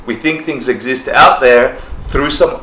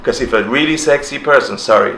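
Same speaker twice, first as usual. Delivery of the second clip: ordinary talk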